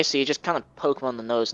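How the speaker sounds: ordinary talk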